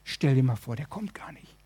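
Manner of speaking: low voice sinking into a whisper; acted quiet misgiving, almost whispered at the end; hushed doubt. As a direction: voice a secret worry, fading away. Man doubts to himself, worried.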